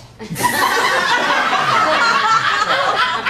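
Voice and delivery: high, loud burst of laughter; real delighted laugh after the punchline. Real, not acted. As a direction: crack up at your own joke. Storyteller cracks up at punchline.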